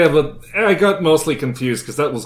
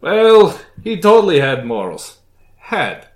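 villain voice; 3 semitones higher, 7 dB louder than usual. Acted. Villain gloating loudly.

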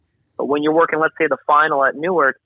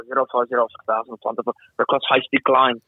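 put-on German accent; 3 semitones lower than usual. Lufthansa pilot reading back to the controller, German accent. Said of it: "this is my best German accent".

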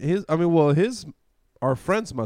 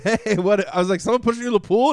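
much higher, louder mock-surprised question; retold surprise; 9 semitones higher, 5 dB louder than usual. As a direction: ask it high and loud, playing up the surprise. Asks soaked friend what happened.

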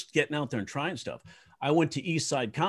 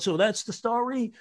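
hoog geroepen vraag naar het podium; high called question; 10 semitones higher than usual. Retold or imitated. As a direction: roep het hoog als een vraag. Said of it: "yell out".

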